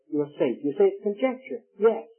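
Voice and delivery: ordinary talk, nothing marked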